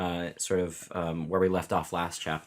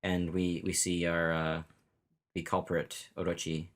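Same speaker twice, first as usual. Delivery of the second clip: usual speaking voice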